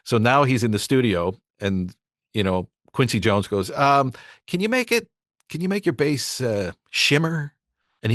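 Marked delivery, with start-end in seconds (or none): 3.7-7.5 higher voice, slow on shimmer; mock a vague producer note, pitched up; producer request. Imitating [name]' request.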